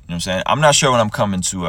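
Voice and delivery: normal talking voice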